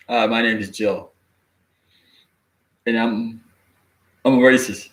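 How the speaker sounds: low, sheepish support-group confession; low mock admission as Joe at a recovery meeting. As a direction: own up hesitantly at a meeting.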